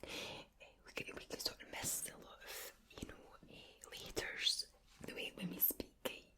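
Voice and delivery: whispered Glasgow Scots, rolled R's; soft Glaswegian ASMR whisper; Glaswegian accent. Shown as a demonstration. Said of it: "So the Glaswegian accent is one that".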